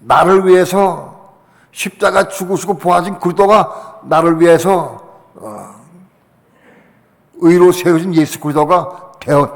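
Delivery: ordinary talk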